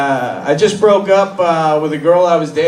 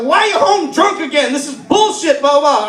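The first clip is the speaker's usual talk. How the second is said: mock scolding girlfriend; 9 semitones higher than usual. Girlfriend scolds drunk comic.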